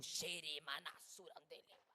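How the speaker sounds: low, slow mumbled tongues; mock speaking in tongues. Preacher mimics a church worker praying in tongues, low and slow.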